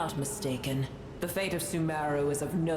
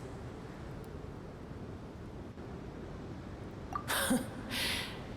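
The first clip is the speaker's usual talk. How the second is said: soft scornful laugh; 9 dB quieter than usual. Acted. Dragon scoffing at request.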